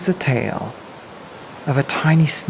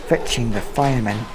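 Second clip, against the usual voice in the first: plain reading voice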